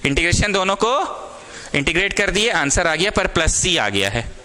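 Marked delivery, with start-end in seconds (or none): none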